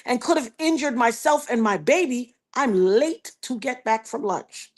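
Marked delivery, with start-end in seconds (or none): none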